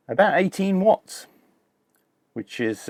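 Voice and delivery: usual speaking voice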